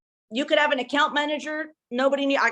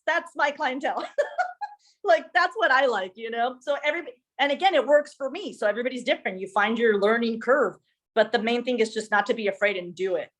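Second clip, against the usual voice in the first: usual speaking voice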